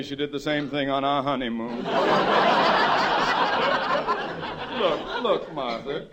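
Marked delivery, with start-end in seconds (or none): 1.8-4.7 high airless sobbing; wailing sob. Acted. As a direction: sob in breathless gasps. Wife wailing again.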